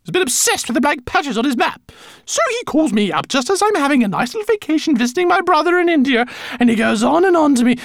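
high, fast, loud grumbling; complaining rat voice. Acted. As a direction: rattle off her grievance. Lola complains.

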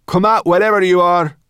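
high, loud demand into the dark; brownie challenge. Acted. Brownie girl daring the hidden thing to come out, loud and high. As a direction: dare whatever hides to show itself.

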